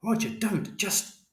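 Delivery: high-pitched protest, held back in volume; mother's shout. A narrator's reading. Mother trying to stop Roger, high urgent protest. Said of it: "And Mother shouted".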